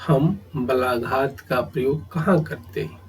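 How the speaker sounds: ordinary delivery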